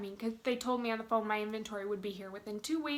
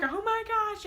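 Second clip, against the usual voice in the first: high-pitched excited outburst, retold; freaking out; 11 semitones higher, 4 dB louder than usual. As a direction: re-enact a giddy "can't believe it" cry. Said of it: "freaking out".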